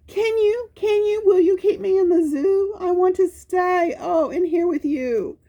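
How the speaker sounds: very high, fast begging voice; a little dog begging the keepers to keep him; pleading Spot. Acted. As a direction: plead high and urgent like a small pup.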